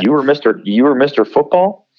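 dismissive disbelief. Retold or imitated. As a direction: imitate a teammate's scornful double take. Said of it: "shunning me".

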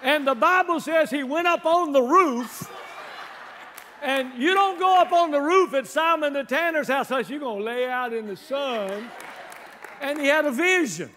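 big, punchy revival preacher voice; energetic preaching. Real, not acted. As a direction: drive the story home to the congregation. Preaches the rooftop story.

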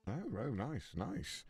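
normal talking voice

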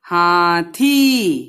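हाथी said very slowly with a long held ई; long drawn vowel. Shown as a demonstration. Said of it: "लंबा".

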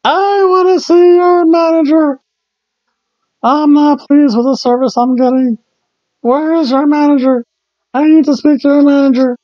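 high-pitched complaining customer, held up on one note; whiny Kmart mom. Acted. Kmart mom demanding the manager, high whiny impression. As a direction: whine it high and loud, drawing out the ends. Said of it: "she sounds like whiny Kmart mom".

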